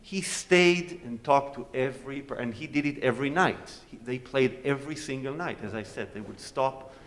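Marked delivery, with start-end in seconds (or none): none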